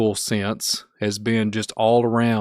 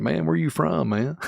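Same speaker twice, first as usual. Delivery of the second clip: put-on Kentucky accent; 7 semitones higher than usual. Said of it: "a lot of people on here are like".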